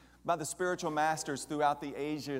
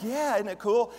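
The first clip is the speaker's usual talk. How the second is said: excited; 8 semitones higher, 8 dB louder than usual. Retold or imitated. Retelling own excited reply.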